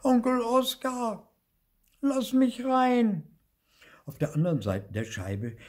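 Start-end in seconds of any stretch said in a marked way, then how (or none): 0.0-3.2 very high, loud, slow plea; little ghost calling through a pane; Zipfel. A narrator's reading. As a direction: call out to be let in, stretching the words. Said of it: "Zipfel trommelte gegen die Scheibe".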